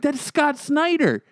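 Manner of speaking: stunned disbelief. Retold or imitated. Stunned telling friend off.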